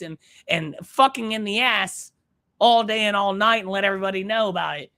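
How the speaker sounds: an outraged host ranting at full pitch; angry rant. Acted. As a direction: work yourself into a furious rant. Angry podcast rant, raised voice.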